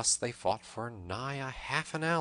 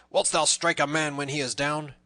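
raised, louder protest; indignant roar; 6 semitones higher, 7 dB louder than usual. A narrator's reading. Downed Little John challenges the tanner, loud protest. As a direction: protest loudly at an unfair blow. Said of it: "roared Little John".

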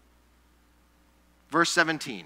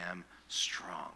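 soft whispered close; 9 semitones lower, 14 dB quieter than usual. Acted. Preacher ending the Bible reading, whispered. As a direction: breathe the line out in a whisper.

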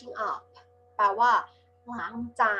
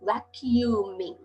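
"Vacuuming" stretched out slowly, each syllable va-cu-u-ming spoken apart; slow syllable drill; much slower than usual. Shown as a demonstration. Teacher slows "Vacuuming" down for Thai pupils, syllable by syllable. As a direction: break "Vacuuming" into slow separate syllables for the class. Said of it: "เร็วๆเป็น vacuuming".

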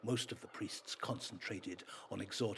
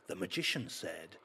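ordinary delivery, nothing marked

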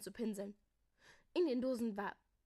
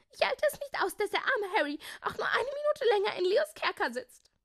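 loud, high, agitated protest; scolding outburst; 11 semitones higher, 11 dB louder than usual. A narrator's reading. Melissa cannot bear Harry staying in the dungeon, scolding. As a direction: pour it out impatiently at top pitch. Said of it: "schimpfte Melissa".

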